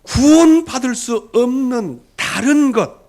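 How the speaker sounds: loud, high, rising sermon cry; preaching at full force. Acted. Preacher crying out to the congregation about idolatry, loud and high. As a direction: preach it out, pressing hard on every phrase.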